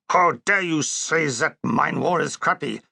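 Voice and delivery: comic German dictator, offended; German accent, affronted; mock German Hitler.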